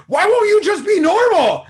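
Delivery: very high, loud mock shriek; screaming meme mother. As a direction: shriek it like the frazzled mum in the meme. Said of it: "the mother is turning around in the car".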